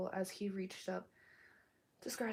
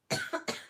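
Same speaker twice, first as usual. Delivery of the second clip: loud cough cut off by a fuck; hack, then swear; cough and curse; 7 dB louder than usual. Real, not acted. Sick reader coughs loudly mid-sentence and swears. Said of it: "Still very sick".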